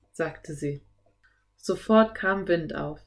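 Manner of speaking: plain reading voice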